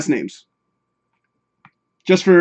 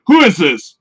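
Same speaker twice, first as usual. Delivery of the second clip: mock-offended question, louder; hostile; 3 semitones higher, 5 dB louder than usual. Shown as a demonstration. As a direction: read it as a confrontational challenge. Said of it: "meanwhile, I'm thinking".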